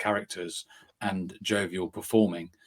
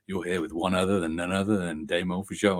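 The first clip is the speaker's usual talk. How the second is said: lightly imitated podcast intro voice; a hint of announcer patter; Dan's show intro; 3 semitones lower than usual. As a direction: mimic a co-host opening the show. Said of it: "it's Dan's voice".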